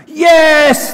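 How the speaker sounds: a high, loud, eager Yes!; crowd shouting yes. Retold or imitated. Crowd answers the tightrope walker with a loud, high shout. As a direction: cheer it back like a whole crowd. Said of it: "they shouted".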